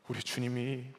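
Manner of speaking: ordinary talk, nothing marked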